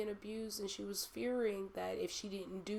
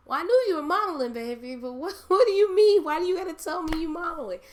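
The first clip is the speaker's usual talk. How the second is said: high, loud, fast doting auntie voice; voicing a loving great aunt, fast and high; imitating auntie; 11 semitones higher, 16 dB louder, faster than usual. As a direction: play the auntie, pitched up and laughing in disbelief. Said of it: "She's like".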